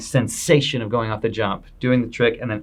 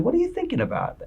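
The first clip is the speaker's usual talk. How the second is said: much higher, softer, fast, as if baffled; puzzled question; 5 dB quieter, faster than usual. Retold or imitated. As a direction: ask it high and baffled. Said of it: "And I said".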